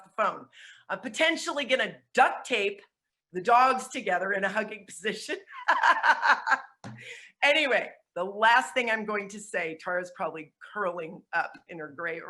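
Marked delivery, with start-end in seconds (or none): 5.4-7.1 real laugh. Real, not acted. Laughs at her own joke.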